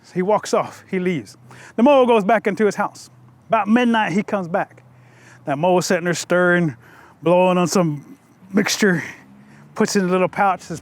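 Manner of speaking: usual speaking voice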